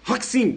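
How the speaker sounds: "Roxin" high, rock and sim joined as HOK-sin; joined-up pronunciation. Shown as a demonstration. Teacher joins the two halves, correct pronunciation. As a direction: punch "Roxin" as one word with ks.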